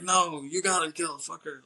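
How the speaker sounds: acted-out angry accusation; retold angry retort. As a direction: imitate someone pissed off, throwing the blame back. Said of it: "he's really pissed off".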